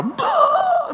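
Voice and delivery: high, drawn-out imitation wail; mock bawling. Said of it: "ela começou a chorar". (choro imitado, agudo e escancarado)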